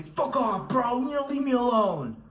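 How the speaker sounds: high, loud mock snap at a friend; mock angry outburst. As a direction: fake a bratty furious brush-off. Mock angry reply to accountability partner.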